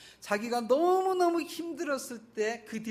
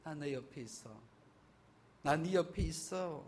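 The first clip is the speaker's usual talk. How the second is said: soft and slow, close and hushed; tender reassurance; 2 semitones lower, 6 dB quieter than usual. Retold or imitated. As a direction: soothe him gently, as if beside him. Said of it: "쓰다듬으면서".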